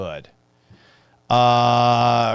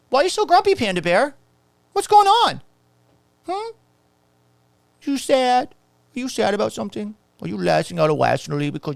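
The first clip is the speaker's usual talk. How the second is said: put-on much higher, sing-song baby talk; mock baby talk; 10 semitones higher than usual. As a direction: coo at the grumpy commenter like a toddler. Baby talk to grumpy commenter.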